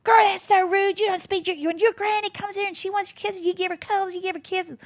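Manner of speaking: high, shrill-pitched scolding Texan mum; mother telling off her daughter, high mock scolding; ballistic mum. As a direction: scold the child like a furious mother. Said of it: "her mum went ballistic".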